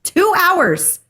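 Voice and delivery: high, drawn-out exasperated complaint; so mad. Retold or imitated. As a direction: act out fuming at the receptionist, slowly stretched. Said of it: "I was so mad".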